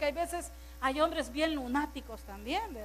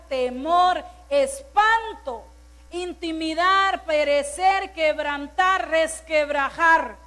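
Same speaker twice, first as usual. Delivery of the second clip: loud, slow, word-by-word sermon list; slow, forceful preaching cadence; emphatic preaching; 7 dB louder, slower than usual. Acted. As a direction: hammer each word home from the pulpit. Preacher lists fearful words forcefully.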